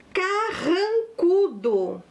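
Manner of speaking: "carrancudo" drawn out syllable by syllable; slow dictation. Shown as a demonstration. Teacher gives pupils the next word, slow dictation.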